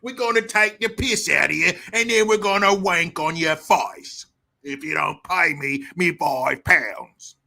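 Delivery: hammed-up London debt collector; Guy Ritchie gangster accent. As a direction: threaten in a mock London accent. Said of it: "dudes from the Guy Ritchie movies".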